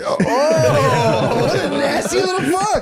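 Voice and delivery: high, cackling mock outrage; laughing insult. Real, not acted. Laughing at friend's reply.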